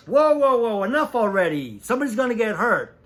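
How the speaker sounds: loud, high protest cutting the takes short; retelling a costar's loud outburst on set; mock yell. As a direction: shout the other actor down to stop the fight. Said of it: "Ray goes".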